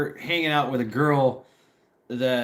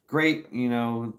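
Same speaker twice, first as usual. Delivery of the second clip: ordinary delivery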